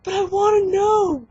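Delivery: high, loud, drawn-out mock whine; childish whine. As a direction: whine back at mom, stretching it out. Retold whining back at mom.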